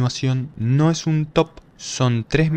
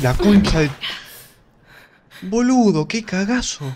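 panicking gamer; 8 semitones higher, 3 dB louder than usual. Real, not acted. Jump scare in horror game.

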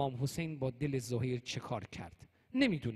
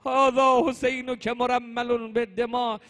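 high, loud chanted outcry; imitated grief-stricken call to heaven; retold lamenting cry; 7 semitones higher, 9 dB louder, slower than usual. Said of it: "فریاد زد".

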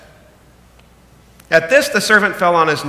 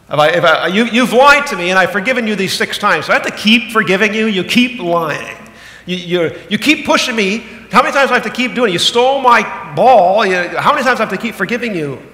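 louder, higher, fed-up and whiny; exasperated complaint; 5 semitones higher, 6 dB louder than usual. Retold or imitated. Preacher voicing a fed-up person asking how often to forgive, exasperated. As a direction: play the sibling who's had enough.

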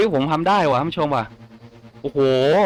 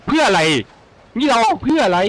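high, louder baffled complaint; exasperated; 7 semitones higher, 3 dB louder than usual. Real, not acted. Player baffled by his own gear, exasperated high cry. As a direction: throw the question up in disbelief.

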